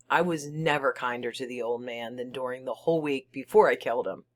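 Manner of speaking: ordinary delivery, nothing marked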